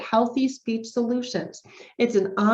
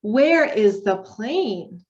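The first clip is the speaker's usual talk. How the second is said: slow, bright questions asked high and louder; reading to a child; 6 semitones higher, 4 dB louder, slower than usual. Shown as a demonstration. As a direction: ask it like you're coaxing a toddler to answer. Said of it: "We can talk about who, where responses".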